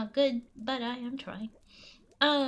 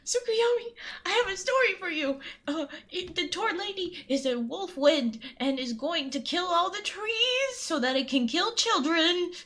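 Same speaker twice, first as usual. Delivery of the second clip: loud, high, alarmed storytelling; hammed-up alarm for the listener; dramatic warning; 4 semitones higher, 4 dB louder than usual. Urgent warning told as story.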